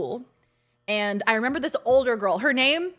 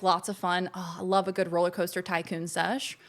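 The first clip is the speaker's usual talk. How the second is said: usual speaking voice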